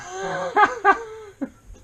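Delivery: loud high laughing fit; real uncontrolled laughter; high cackling laugh. Real, not acted. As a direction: burst out laughing hard. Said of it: "if only James was here".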